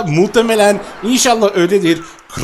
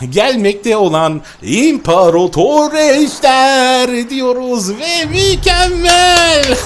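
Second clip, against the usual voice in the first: slow, loud, drawn-out announcer call; grand announcement; 5 semitones higher, 3 dB louder, much slower than usual. Acted. Gamer announces the arriving emperor dragon to viewers, slow announcer call. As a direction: present it like a ringside announcer.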